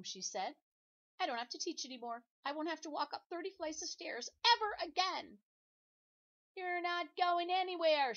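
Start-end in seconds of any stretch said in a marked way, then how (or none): 1.2-5.4 higher, louder, quick and delighted; gleeful teacher. Acted. Missus Scorf rejoices to her apple-filled class, gleeful and high. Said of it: "Missus Scorf was very happy". 6.5-8.1 slow, much louder, higher defiant yell; shout. Acted. Todd the apple stops his teacher leaving, shouting. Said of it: "shouted Todd".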